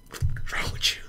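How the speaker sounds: hushed unvoiced whisper. Imagining snubbing someone as an actor, whispered. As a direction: breathe it out as a secret jab. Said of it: "I could just be like".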